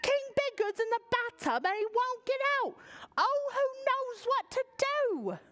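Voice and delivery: high, frantic page voice; panicked page. Acted. Page's line continues.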